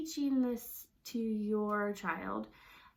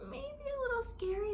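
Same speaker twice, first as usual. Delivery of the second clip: a little scared; 12 semitones higher than usual. Shown as a demonstration. Teacher replaying the scary line, timid and high. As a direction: squeak it out like you're nervous. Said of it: "and we changed our face".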